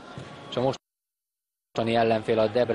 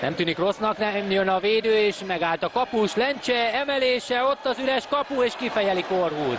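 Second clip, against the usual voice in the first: excited commentary; 10 semitones higher than usual. Real, not acted. Chance in front of goal.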